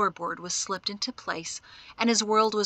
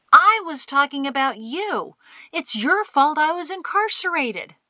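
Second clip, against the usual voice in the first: high, raised, exasperated voice; loud, pitched-up protest; indignant accusation; 8 semitones higher, 5 dB louder than usual. A narrator's reading. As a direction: throw the blame back hotly. Shin blames old man in quarrel.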